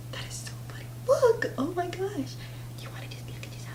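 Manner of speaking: delighted hushed whisper. Real, not acted. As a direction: whisper softly, amused and thrilled. Whispers while bird looks in mirror.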